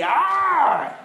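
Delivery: high, drawn-out mock scream; demonstrated shriek of great fear; fearful scream. Shown as a demonstration. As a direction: cry out as if terrified. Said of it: "they feared a great fear".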